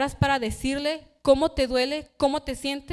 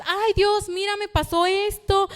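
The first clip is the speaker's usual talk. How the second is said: higher, breezy small-talk prayer; mock an offhand chat with God; a light mocking of trivial prayers; casual chatty prayer; 8 semitones higher, 4 dB louder than usual. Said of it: "para que tú le digas".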